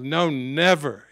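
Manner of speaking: loud, slow, drawn-out refusal; loud protest. Retold or imitated. Peter protesting loudly to Jesus, slow and loud. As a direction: plant your feet and protest. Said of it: "he started protesting quite loud".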